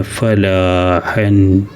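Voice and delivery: ഫലാഹൻ very slow, ലാ held long before ഹൻ; teacher blending ഫലാഹൻ slowly; long ലാ sound. Shown as a demonstration. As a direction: stretch ഫലാ then add ഹൻ, very slowly. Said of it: "ഫലാ നീട്ടാണ്ട് പിന്നെ ഹൻ".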